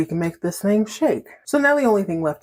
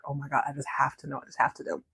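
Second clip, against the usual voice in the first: softer and fast, fading to a whisper; desperate whisper; 4 semitones lower, 9 dB quieter, faster than usual. Retold or imitated. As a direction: beg to know, breathless. Desperate curiosity, acted.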